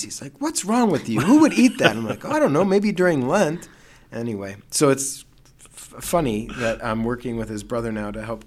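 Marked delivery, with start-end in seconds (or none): 0.4-2.0 high, loud exasperated outburst; seminary classmate appalled at a tuna ice cream flavor; appalled disbelief. Retold or imitated. Classmate's appalled reaction to joke flavor. 2.2-3.7 high, louder playful shrug; teasing mock innocence. Teasing reply to classmate.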